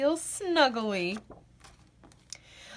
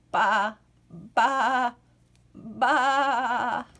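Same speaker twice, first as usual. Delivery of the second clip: loud playful baa; sheep bleat; 4 dB louder, much slower than usual. Acted. Counting sheep in the story, loud playful bleating.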